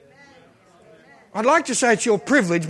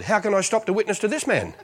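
normal talking voice

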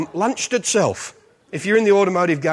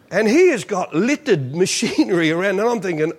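ordinary talk, nothing marked

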